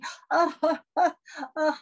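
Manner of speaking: high theatrical laugh in bursts; put-on laughter. Actress amused by Harry juggling balls, put-on laughter. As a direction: laugh showily at the surprise.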